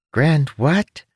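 high, odd little creature voice; chirpy winged creature, helpful. Acted.

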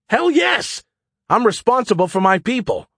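high, emphatic indignant cry; indignant outburst. Acted. Trapped pilot defending concern for his people, indignant. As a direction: burst out in fierce protest.

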